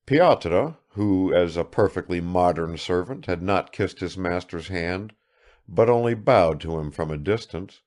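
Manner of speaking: ordinary delivery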